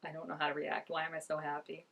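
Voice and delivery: low, hushed bewilderment; imitated stunned reaction. Viewer voicing his shocked face, soft and low. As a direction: softly voice someone quietly thrown. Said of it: "He's, like, shocked".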